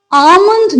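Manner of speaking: almond said AA-mund, no L; silent L. Shown as a demonstration. Teacher using the word in a sentence, silent L. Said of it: "तो आप कैसे कहेंगे".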